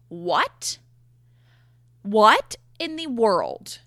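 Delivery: loud, slow disbelief; stunned what. Retold or imitated. Retelling the shock of seeing the chiropractor, loud and slow. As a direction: drag it out, loud and incredulous.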